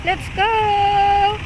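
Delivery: high, long-held rallying calls; urging a dog on. Real, not acted. Owner urges Kari to get going, drawn-out high calls. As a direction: stretch out "go" high to spur the dog.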